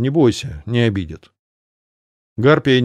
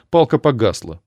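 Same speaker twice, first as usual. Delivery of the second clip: plain reading voice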